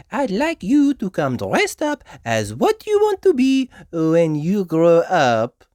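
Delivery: high, slow, gentle French teacher; Madame Gazelle teacher voice. A narrator's reading. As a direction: address the class like a sweet schoolmistress.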